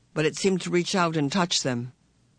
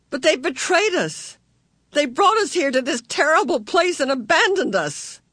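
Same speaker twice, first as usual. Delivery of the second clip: distressed outburst; 11 semitones higher, 5 dB louder than usual. A narrator's reading. Meg's outraged despair.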